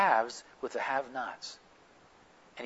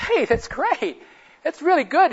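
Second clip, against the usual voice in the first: much higher and louder, slow, beaming praise; excited praise; 13 semitones higher, 11 dB louder, slower than usual. Retold or imitated. Father cheers the adult who just read a sentence, beaming loud praise. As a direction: cheer the man on with big delight.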